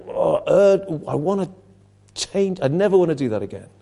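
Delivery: ordinary talk, nothing marked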